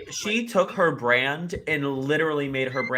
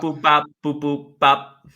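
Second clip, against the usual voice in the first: toy robot voice; 9 dB louder than usual. Pretend. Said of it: "It's giving Mister Krabs".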